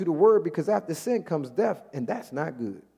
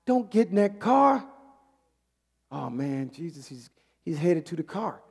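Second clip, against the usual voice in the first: ordinary delivery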